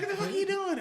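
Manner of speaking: high-pitched imitated indignation; mock outraged cry. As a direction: squeal it like a scandalized girlfriend, half laughing. Girlfriend's outraged reaction retold.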